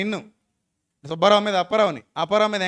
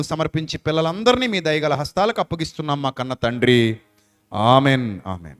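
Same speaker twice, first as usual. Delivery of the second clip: lower, solemn prayer voice with fervent swells; closing prayer; 7 semitones lower than usual. Real, not acted. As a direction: pray over the congregation like a pastor.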